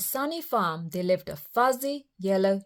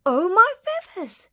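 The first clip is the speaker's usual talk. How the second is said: very high, startled chick voice; squeaking chick; 4 dB louder than usual. A narrator's reading. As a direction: squeak it in alarm. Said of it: "he squeaked".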